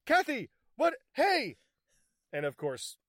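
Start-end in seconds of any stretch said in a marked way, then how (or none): 0.0-1.6 much higher, louder frantic call; alarmed calling. Retold or imitated. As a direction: call after her in a hammed-up panic. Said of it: "Cho's like".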